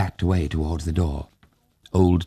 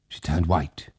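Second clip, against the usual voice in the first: ordinary delivery, nothing marked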